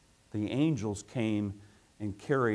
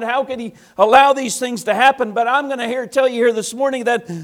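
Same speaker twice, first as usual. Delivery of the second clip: preaching at full force; 13 semitones higher, 12 dB louder than usual. Acted. Preacher building.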